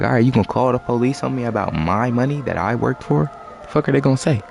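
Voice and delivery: indignant comeback. Retold or imitated. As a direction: throw it back at grandma in disbelief. Arguing back at grandma.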